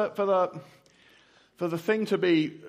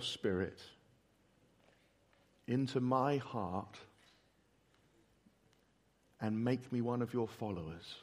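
prayer; 3 semitones lower than usual. Acted. Leads the congregation in prayer.

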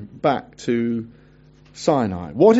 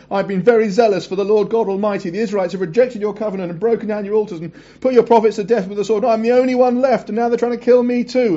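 Elijah's heated complaint; 5 semitones higher, faster than usual. Acted. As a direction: plead your case to God like a prophet at the end of his rope. Elijah's complaint reread with feeling.

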